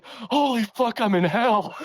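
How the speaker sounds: high-pitched, hammed-up panic with a laugh breaking through; mock terrified outcry. Podcaster acting out Byron waking beside his new wife, mock horror. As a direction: play Byron jolting awake sure he's in hell, half laughing.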